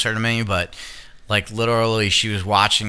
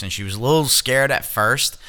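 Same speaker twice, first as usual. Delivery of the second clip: ordinary talk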